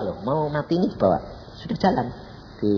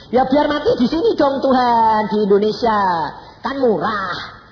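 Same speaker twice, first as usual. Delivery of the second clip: loud, high-pitched plea to God; acted loud bargaining; pleading out loud; 7 semitones higher, 6 dB louder than usual. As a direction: argue your case, raised voice. Acts own plea to God.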